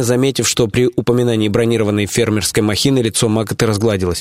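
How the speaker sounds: plain reading voice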